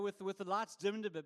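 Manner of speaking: ordinary talk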